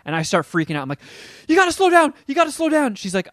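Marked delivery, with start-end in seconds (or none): 1.5-2.9 a frantic high-pitched plea; panicked plea. Retold or imitated. Tripping, terrified of the speed.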